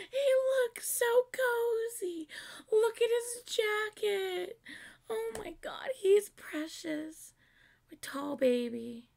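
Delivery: high, drawn-out airy squeal; adoring coo. Real, not acted. Fan gushing over singer's jacket, adoring high coo. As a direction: coo slowly in a high, melting voice.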